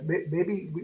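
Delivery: ordinary talk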